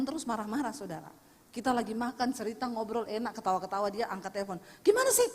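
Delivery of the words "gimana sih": much higher, louder scolding of the staff; angry phone yell. Retold or imitated. Rich man scolding his staff on the phone, shouting. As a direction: bark orders down the phone.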